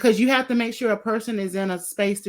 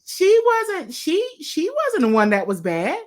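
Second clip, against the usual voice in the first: excited; 6 semitones higher, 4 dB louder than usual. Real, not acted. Emphatic excited point to guest.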